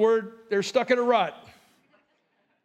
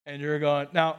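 ordinary talk

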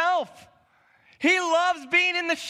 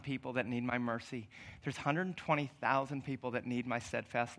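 much softer, lower, tender voice of God; gentle God; 13 semitones lower, 11 dB quieter than usual. Acted. God gently reasons with Jonah about Nineveh, softly. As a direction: speak as a patient, loving God.

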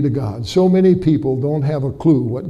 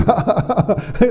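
high, real-sounding laughing fit; retold laughter; 6 semitones higher than usual. Butcher laughing in his freezing cooler, high laugh. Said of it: "pretty soon he says".

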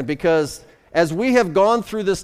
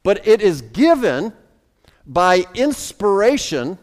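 ordinary talk

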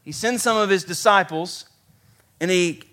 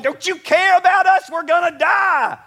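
high, loud panicked shout; acted-out frantic yell; freaking out; 12 semitones higher, 5 dB louder than usual. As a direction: cry out in alarm for your life. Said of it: "they're all freaking out".

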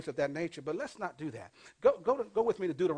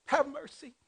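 mock pleading; 9 semitones higher, 3 dB louder than usual. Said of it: "And then all of a sudden you go".

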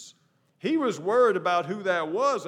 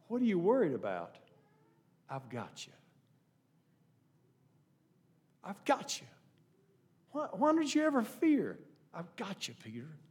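softer, tender voice of Jesus holding him; gentle reassurance; 5 dB quieter than usual. Retold or imitated. Preacher voices Jesus reassuring Peter softly, tender. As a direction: hush him kindly, like catching a frightened friend. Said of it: "I'd rather look at it this way".

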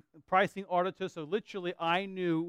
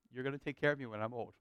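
joking; 5 semitones lower, 8 dB quieter, faster than usual. Retold or imitated. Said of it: "we say that jokingly".

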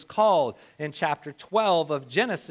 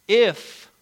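"If" set apart, loud and isolated; a sharp, louder "If" after a pause; stress on "If"; 7 dB louder than usual. Real, not acted. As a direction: punch "If" as the rejected word. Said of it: "he didn't say".

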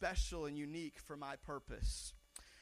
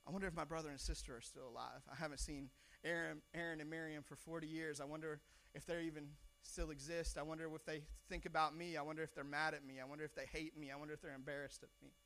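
quiet musing; 3 semitones lower, 9 dB quieter than usual. Pretend. Preacher voices Moses' lonely musings.

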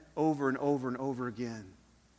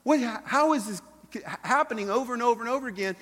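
a higher-pitched exasperated king; a mildly acted puzzled outburst; imitating an enemy king baffled by his failures; frustrated king; 8 semitones higher than usual. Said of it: "he's like".

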